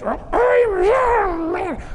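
put-on high, crying child's protest; whiny kid voice. Caught daughter whines to her dad, high and crying. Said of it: "child answers caught in the act".

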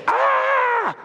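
sudden high yelp of shock; shocked yell. Retold or imitated. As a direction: cry out in horror at the bride. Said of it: "And he goes".

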